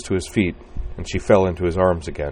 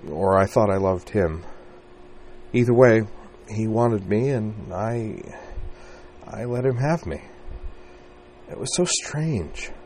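strained confession; 5 semitones higher than usual. Acted. Emotional confession, rising.

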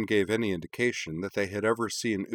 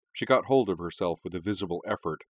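plain reading voice

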